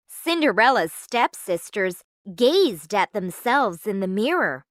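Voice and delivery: ordinary delivery, nothing marked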